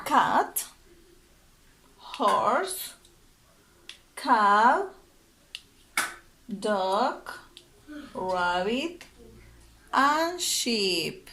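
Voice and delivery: each word called out loud and separate; loud drill words with pauses between; vocabulary drill. Shown as a demonstration. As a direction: model every word clearly for the class to echo.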